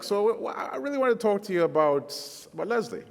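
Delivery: nervous stammer. Retold or imitated. Preacher retelling asking his future father-in-law, nervous stammer. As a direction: stumble nervously over the words. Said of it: "it was like".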